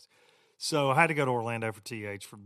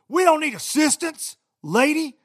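much higher and louder, imitating the man yelling; retold yelling; 13 semitones higher, 10 dB louder than usual. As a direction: yell it like the angry passenger. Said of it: "So he's, like, yelling at this lady".

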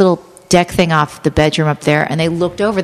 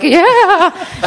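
high, loud, laughing drawn-out yeah; a sly laughing yeah, pitched high; laughing mock yeah; 13 semitones higher, 4 dB louder than usual. Mimicking the ladies' flirty vibe with a high laugh.

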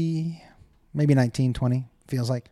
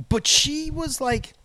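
ordinary delivery

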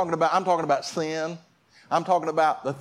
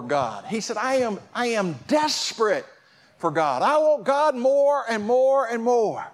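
raised, urgent sermon delivery; impassioned preaching; 4 semitones higher than usual. Acted. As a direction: preach it with hunger, stretching the key words.

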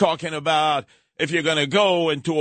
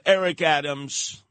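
usual speaking voice

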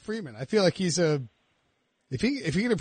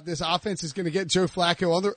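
ordinary delivery

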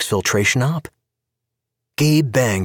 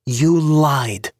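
sharp, raised accusation, higher and louder; accusing Tanya; 7 semitones higher, 3 dB louder than usual. A narrator's reading. Tanya confronts Gabe in the bar: sharp accusing line. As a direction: spit the accusation at him.

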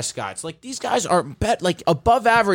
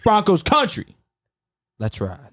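Russell Wilson catchphrase take; 3 semitones higher, slower than usual. Shown as a demonstration. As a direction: pitch up the team name, sink on the send-off. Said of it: "I'm doing two of them".

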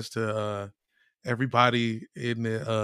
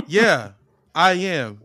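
ordinary talk, nothing marked

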